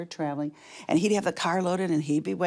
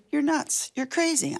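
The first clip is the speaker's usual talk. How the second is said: high, playful protest; retold incredulous dismissal; 7 semitones higher than usual. Wife answering husband's offer, high mock incredulity. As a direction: brush it off in mock disbelief, pitched up.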